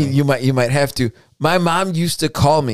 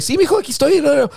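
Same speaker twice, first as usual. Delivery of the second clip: imitating mom, high and breezy in Spanish; high-pitched, carefree mother on the phone; untroubled mom; 11 semitones higher than usual. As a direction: answer as if nothing were wrong. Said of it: "it sounded like that".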